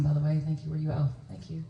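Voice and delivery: ordinary talk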